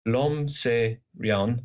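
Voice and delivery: put-on slow, deliberate French accent. Podcaster reading Holmes's Flaubert quote, French accent. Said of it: "That's my French accent".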